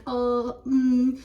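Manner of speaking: drawn-out "अ, उम"; hesitant fillers. Shown as a demonstration. Teacher showing fillers to avoid, drawn-out hesitation. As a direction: hold each filler as a long, level hum. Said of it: "avoid fillers like".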